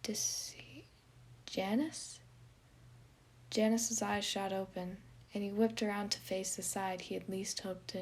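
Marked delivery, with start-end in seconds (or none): 0.0-2.2 low hesitant voice. Acted. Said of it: "a low, familiar voice".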